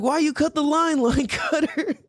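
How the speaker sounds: high taunting call with a laugh; retold heckling yell. Driver taunting the line cutter, retold heckle. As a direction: needle her in a high mock call. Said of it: "what I yelled at her".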